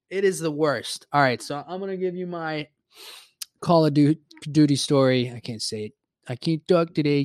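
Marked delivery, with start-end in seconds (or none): none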